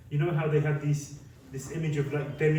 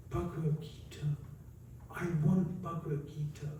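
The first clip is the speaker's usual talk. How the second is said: tearful; 7 dB quieter than usual. Retold or imitated. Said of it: "And she starts crying".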